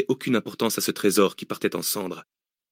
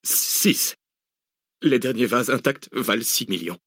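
lightly faltering captive; a touch of frightened stammering; mumbled stammer; 3 semitones higher, slower than usual. A narrator's reading. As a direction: stumble into a hesitant answer. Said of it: "Dimitri bredouilla".

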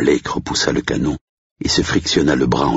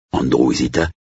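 usual speaking voice

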